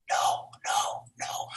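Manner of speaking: whispered, tearful no no no; shy whispered refusal. Retold or imitated. Shy Giselle refusing to come on stage, whispering. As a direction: shrink away, pleading in a whisper. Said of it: "She was very shy".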